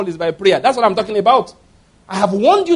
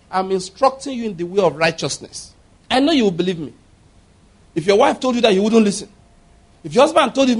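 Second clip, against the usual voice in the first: ordinary delivery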